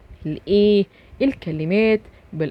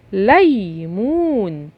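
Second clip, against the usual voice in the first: "ليمون" drawn out very slowly; slow demo reading; much slower than usual. Shown as a demonstration. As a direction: stretch out "ليمون" for the kids. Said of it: "فيها مد بالواو".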